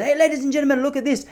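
high, loud market barker; showman call. Retold or imitated. Stallholder calling out to passers-by.

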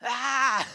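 sudden high yell of frustration; dream shout. Retold or imitated. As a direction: let out a loud anguished shout. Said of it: "så ropte jeg i drømmen". (høyt, frustrert rop)

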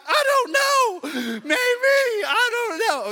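very high, loud, drawn-out wail; hysterically unsure; panicking parent. Retold or imitated. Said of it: "Freaking out, freaking out".

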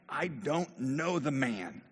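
very slow, emphatic denial; acted vehement disowning; sworn denial. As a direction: stretch out each word insistently. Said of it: "it says Peter swore".

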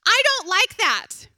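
loud, slow whiny refusal; picky child whine. Retold or imitated. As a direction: whine like a fussy kid. Child voiced refusing dinner.

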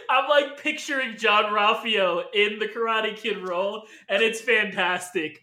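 normal talking voice

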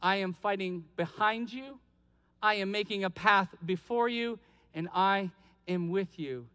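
low, soft voice of God, unhurried; God's reassurance. Retold or imitated. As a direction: reassure them quietly as the Lord. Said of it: "what does the Lord say".

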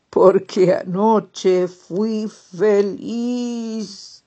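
slow, loud, contented; happy toad voice. Acted. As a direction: savor each word, beaming. Happy toad's final answer.

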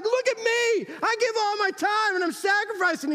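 preacher mocks a churchgoer boasting about giving time; mock proud giver. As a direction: play the smug giver showing off his sacrifice. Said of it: "You're like".

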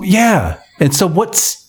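normal talking voice

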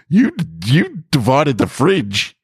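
high, slow incredulity; high and disbelieving, drawn out; incredulous. Acted. Incredulous retort in argument.

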